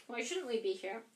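usual speaking voice

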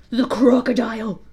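loud gasped exclamation; alarmed gasp. A narrator's reading. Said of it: "he gasped".

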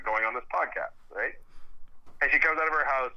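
ordinary talk

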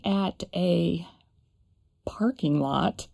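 breathy whisper. Real, not acted. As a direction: drop to a whisper. Sudden whisper mid-story.

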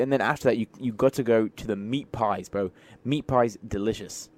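hammed-up British accent, lively and chatty; amateur British accent, playfully put on; British accent attempt. Shown as a demonstration. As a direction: tell it like a British lad. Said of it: "Also, rate my accent down below".

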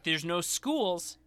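slow, deliberate riddle answer; drawn-out detective reveal. Retold or imitated. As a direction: spell out the answer slowly as it clicks. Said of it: "And it's like".

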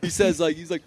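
usual speaking voice

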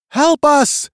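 very high, loud plea; tiny captive shrieking for help; shrill voice. A narrator's reading. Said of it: "he heard in a shrill voice".

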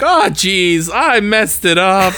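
high, aw-shucks put-on voice; drawn-out, high mock regret; hapless Carl. Carl after screwing it up, high hapless voice.

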